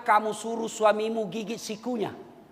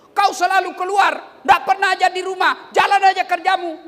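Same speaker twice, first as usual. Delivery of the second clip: loud, higher complaining shout; nagging wife; 7 semitones higher, 8 dB louder than usual. Acted. Wife scolding a husband who is always out, loud and higher. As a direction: berate him for never being home. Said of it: "Kalau ada binimu marah seperti itu".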